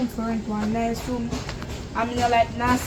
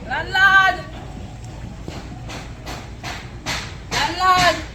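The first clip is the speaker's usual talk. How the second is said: calling out; 7 semitones higher, much slower than usual. Acted. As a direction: call out across the yard. Calling out for the landlord.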